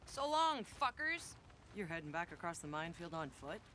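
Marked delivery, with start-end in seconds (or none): 0.0-1.4 high, loud jeering yell; mocking farewell shout. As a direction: shout it back at them as you leave. Departing taunt shouted back.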